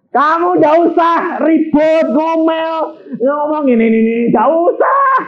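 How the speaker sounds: pleading high warning to the congregation; preaching at full force. Real, not acted. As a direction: drive the warning home from the pulpit. Preacher scolds wives loudly.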